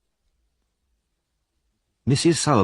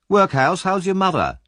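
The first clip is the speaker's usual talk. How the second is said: high, jeering schoolboy voice; sneering bully taunt; 10 semitones higher than usual. A narrator's reading. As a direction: taunt him to make him cry. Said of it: "he asked".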